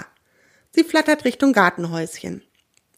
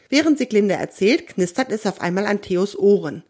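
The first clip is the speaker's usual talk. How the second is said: ordinary delivery, nothing marked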